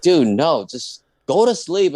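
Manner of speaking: loud, high fed-up command; exasperated order. Retold or imitated. Fed-up friend ordering him to sleep, loud and high. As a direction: snap him back to bed.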